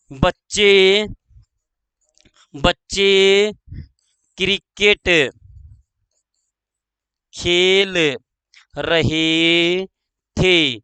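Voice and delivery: word by word, long gaps; slow dictation. Shown as a demonstration. Teacher dictating the cricket example, slow dictation. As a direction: give each word time to be written.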